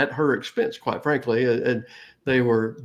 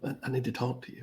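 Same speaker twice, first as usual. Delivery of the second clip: hushed, quick, grave aside; much softer, solemn retold request; serious; 12 dB quieter, faster than usual. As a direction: imitate a friend discreetly pulling someone aside. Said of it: "Take that serious look".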